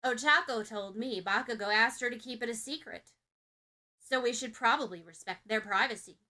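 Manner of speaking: loud would-be secret behind a hand; stage whisper loud enough for the room; failed whisper. A narrator's reading. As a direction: try to whisper but say it loudly. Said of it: "behind her hand, but not at all quietly".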